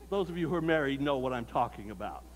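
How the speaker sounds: ordinary delivery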